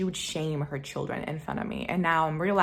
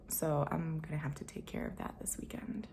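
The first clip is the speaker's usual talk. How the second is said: much softer unvoiced whisper; whispered aside; 3 semitones lower, 10 dB quieter than usual. Real, not acted. Aside to viewers about fixing her light, whispered. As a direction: toss it off under her breath.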